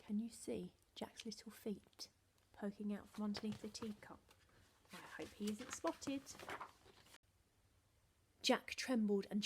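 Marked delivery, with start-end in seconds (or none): 0.1-6.2 soft hushed aside; whispered aside to listeners. A narrator's reading. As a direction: whisper to the children so Jack isn't found. Aside to children, secret.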